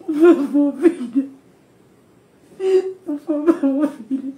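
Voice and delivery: real sobbing pleas through tears; breaking into tears while begging her daughter; crying. Real, not acted. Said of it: "Eu não quero que ela veja eu chorando".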